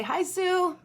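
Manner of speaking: bright, high friendly hello; cheerful greeting. Shown as a demonstration. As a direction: greet her brightly, like a kid. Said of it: "You could say".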